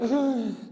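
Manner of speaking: a sharp high gasp of delight; excited gasp. Retold or imitated. Imagined parishioner gasps at God's offer. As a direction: gasp like someone can't believe her luck.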